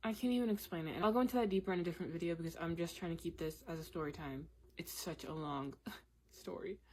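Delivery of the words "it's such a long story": a quiet laugh through the words; laughing softly. Real, not acted. Vlogger laughing at her own long story, quiet laughing. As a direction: let a real chuckle slip in, much softer.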